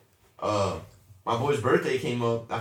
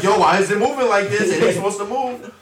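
higher, louder mock panic, half laughing; panicked; 7 semitones higher, 6 dB louder than usual. Scared rider testing the seat, higher and louder. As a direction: yelp it like the seat feels loose.

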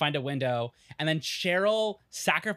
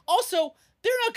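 emphatic. Real, not acted. Emphatic point.

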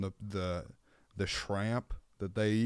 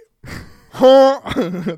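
very loud, high goose honk; mock goose cry; 13 semitones higher, 15 dB louder than usual. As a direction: blurt one big honk. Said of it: "That was my goose".

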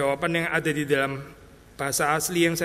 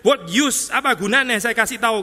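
higher, louder, fed up; exasperated; 5 semitones higher, 4 dB louder than usual. Retold or imitated. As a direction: sigh it out as if worn out from repeating. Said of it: "kesesekannya Yesus".

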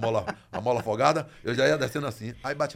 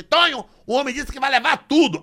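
woman calling her husband; 11 semitones higher, 7 dB louder than usual. Retold or imitated. Mulher chamando o marido.

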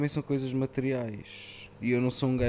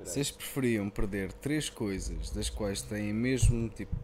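radio announcer voice; 2 semitones lower, slower than usual. Pretend. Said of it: "faz aí voz de locutor de rádio".

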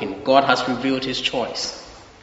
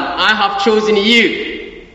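slow voice of God; 6 semitones higher, 5 dB louder, much slower than usual. Acted. Preacher voicing God in Isaiah.

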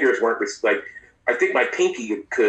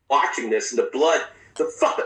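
normal talking voice

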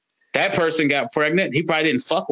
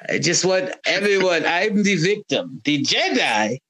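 bad Palpatine impression; 3 semitones higher than usual. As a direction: ham up the wronged emperor. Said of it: "You have the worst Emperor Palpatine accent ever".

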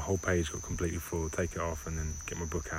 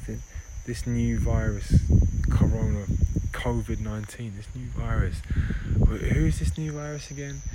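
complaining virus; 4 semitones higher, 6 dB louder than usual. Acted. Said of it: "they're all complaining 'cause they're going".

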